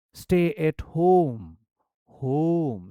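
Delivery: home said slow and loud, with a falling end; stress on home. Shown as a demonstration. Teacher showing the stressed final word to students. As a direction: lean hard on home and draw it out. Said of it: "I'm giving this stress because this is the ending line".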